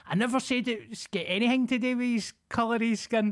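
hammed-up protesting old woman; mock defensive neighbour. Imitating neighbour Moira denying she meant race, high and huffy. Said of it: "neighbour's defensive retort".

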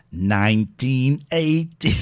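loud, slow nineteen eighty; slow robot voice. Shown as a demonstration. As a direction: drag it out like a machine. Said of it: "Just so I can go".

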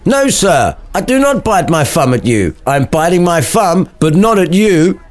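high-pitched hammed-up denial; backtracking. Samson backing down before Abraham, hammed-up backtracking. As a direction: back down in a high put-on voice. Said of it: "Samson quickly backtracked".

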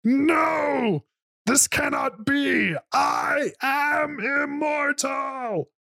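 very high, slow dying scream; a dragon shrieking in disbelief; fire-breathing dragon. Acted. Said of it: "With its last breath".

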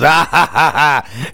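loud, high mock cackle; hammed-up staccato laughing; imitated villain laugh. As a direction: laugh like a mad bread man. Imitates sketch character's maniacal laugh.

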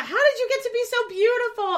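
high mock compliment shouted from a car; TikTok hype guy. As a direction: call it out, pitched high and playful. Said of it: "TikTok account that they drive through Los Angeles".

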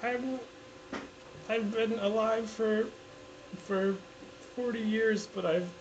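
put-on high, tearful kid's complaint; slow, halting, high-pitched fake sobbing voice; mock crying. Man explaining his age to an interviewer, mock crying. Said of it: "Why are you crying?".

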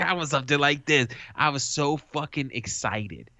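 normal talking voice

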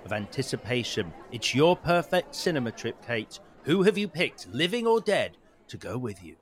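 guided story narration. Acted. Host leads the guest through an imagined night at the movies, narrating. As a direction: paint the scene for the listener.

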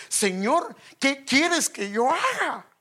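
slow, high, trembling plea to the Lord; trembling humble plea. Acted. Paul trembling answers the Lord.

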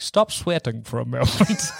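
low mentor voice; a low, composed command; Professor X. Acted. Professor arriving to halt the bullets, in a low voice. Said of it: "Professor X arriving being like".

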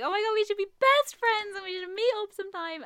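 put-on high, loud girly gush; hammed-up instant-best-friend squeal; gushing new friend. Nightclub girl hyping a brand new friend, high excited gush.

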